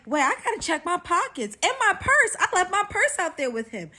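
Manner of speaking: high, loud comic panic; mock alarm. Woman realising her purse is with a thief, mock alarm. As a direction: exclaim in exaggerated alarm. Retold alarm at learning he steals.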